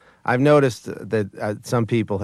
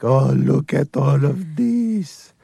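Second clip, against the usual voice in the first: slow, high, amazed newcomer; wide-eyed wonder; 11 semitones higher, slower than usual. Acted. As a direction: gasp in slow wonder at everything. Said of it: "as if to say".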